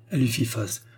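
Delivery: ordinary delivery, nothing marked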